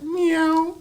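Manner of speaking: very high, thin cat meow; fake cat meow. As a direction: imitate a cat to cover the noise. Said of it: "It's a cat".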